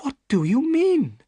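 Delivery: high startled question; surprised. Acted. Miss Stoner, surprised, asks Holmes what he means. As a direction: caught off guard, voice jumps up. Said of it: "asked Miss Stoner in surprise".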